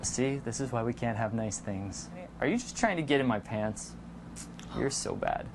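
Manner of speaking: low, soft teasing murmur; playful flirty tease. Shown as a demonstration. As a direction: purr it like a flirty joke.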